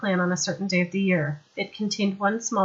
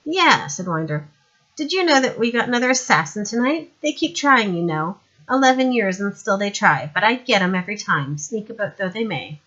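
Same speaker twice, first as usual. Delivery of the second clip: boastful paranoid lord; 2 semitones higher, 5 dB louder than usual. Acted. Lord Winder boasting to Madame, loud smug brag. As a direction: puff up and crow about it.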